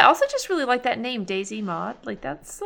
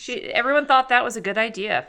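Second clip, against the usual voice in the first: ordinary talk, nothing marked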